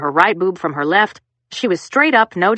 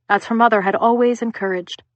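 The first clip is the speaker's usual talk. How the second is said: ordinary delivery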